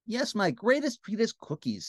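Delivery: a touch of a princess's sigh. Acted. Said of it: "said the princess with a sigh".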